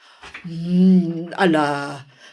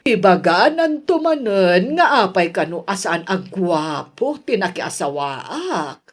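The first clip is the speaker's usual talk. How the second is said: usual speaking voice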